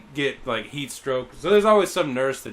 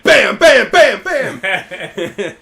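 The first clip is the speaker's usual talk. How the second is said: loud, punchy bams; imitated hard blows; 4 semitones higher, 11 dB louder, much slower than usual. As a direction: slam out each bam like a hit. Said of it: "She hit her in the head really fucking hard".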